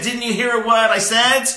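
loud, high mock outburst from the wife; hammed-up furious wife, thrown out loud; angry wife. Rabbi acting the wife's exasperated shout at her husband, loud and high. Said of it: "she gets even more angry with me".

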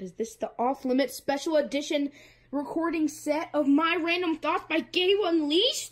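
ordinary delivery

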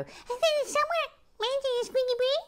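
high little mouse voice; squeaky mouse voice. Pretend.

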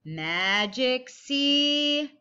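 drawn-out slow Magic C; slow tracing call. Shown as a demonstration. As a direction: stretch it slowly while tracing. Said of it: "everybody get your pencil ready".

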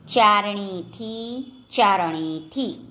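ચારણીથી slowly, syllable by syllable, rising at the end; each syllable of ચારણીથી separated and drawn out; slow word demonstration. Shown as a demonstration. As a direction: stretch out ચારણીથી as for spelling. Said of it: "જોડણી પર ધ્યાન આપજો".

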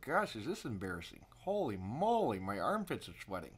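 ordinary delivery

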